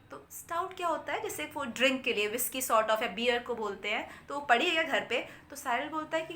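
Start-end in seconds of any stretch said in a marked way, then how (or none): none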